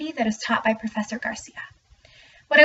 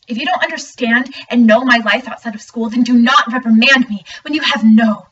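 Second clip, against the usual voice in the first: loud, indignant student's outburst; dramatic monologue; 6 dB louder than usual. Acted. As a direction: push each word out forcefully at the teacher. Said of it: "performing a dramatic monologue".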